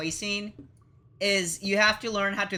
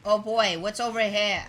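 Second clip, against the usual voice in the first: ordinary talk, nothing marked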